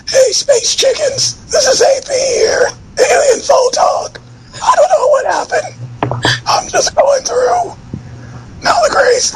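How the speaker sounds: very high, shouty squeaky impression; lunch lady voice. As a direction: screech it like a frantic lunch lady. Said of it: "the lunch lady voice".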